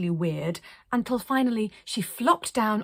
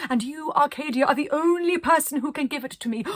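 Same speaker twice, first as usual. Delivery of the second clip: higher, louder grand quote; royal plea; 8 semitones higher, 6 dB louder than usual. Retold or imitated. Arcadia retells the prince's request, higher and louder. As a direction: proclaim the prince's wish.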